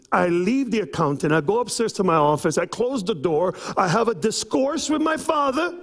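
ordinary talk

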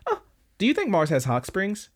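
high teasing lift on the question; playful PM voice. Retold or imitated. PM voiced in retold scene.

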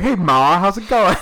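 high, loud mock-folksy hello; jokey greeting. Son-in-law joking with his in-laws, jokey greeting. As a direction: holler a playful hello to Ma.

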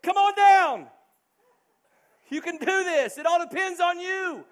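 high, loud shouting through a megaphone; hammed-up megaphone call to the baby; mock megaphone shout. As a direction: bellow it like a game-show host. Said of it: "with a megaphone just saying".

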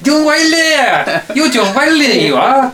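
high, slow, hammed-up Irish greeting; mock Irish accent. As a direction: sing out the name like a thrilled Irish doorman. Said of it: "That's Irish".

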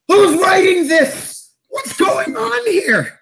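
high, drawn-out cry to the heavens; incredulous yelling. Acted. Player shaking fists at the sky mid-game, incredulous yell.

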